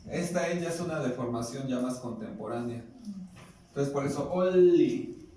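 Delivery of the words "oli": Oli and Malinali said slowly, louder, with no final n; Oli, Malinali — careful teacher demo without the N. Shown as a demonstration. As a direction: stretch Oli and Malinali, dropping the N. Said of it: "sin la N al final".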